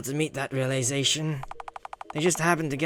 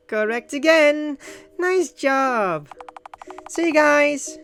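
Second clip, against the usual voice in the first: high, bright, loud praise; slow high-pitched congratulation; cheerful praise; 10 semitones higher, 3 dB louder, slower than usual. Acted. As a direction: cheer them on.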